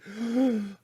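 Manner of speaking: sharp gasp of shock; startled gasp. Acted. Storyteller looking over the boat's edge, startled gasp. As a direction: suck in a breath, startled. Recoils at seeing monster in tale.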